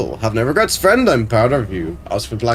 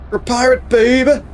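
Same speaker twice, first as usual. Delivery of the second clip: excited cheer; 11 semitones higher than usual. Real, not acted. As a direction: shout it with glee.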